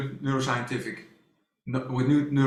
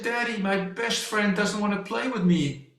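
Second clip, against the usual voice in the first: higher, a hint of a tearful little girl; upset daughter; 6 semitones higher than usual. Father retelling his daughter's complaint, higher and lightly tearful. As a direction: imitate her hurt little voice. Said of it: "And she said".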